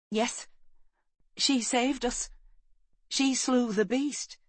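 high-pitched youngster, drawn out; high young voice; young boy's voice. A narrator's reading. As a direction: pitch it up like an earnest boy. Boy answers the rescuing man.

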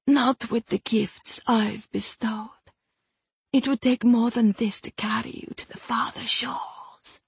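honeyed whisper. Acted. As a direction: whisper it sweetly to him. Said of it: "she said in honeyed tones".

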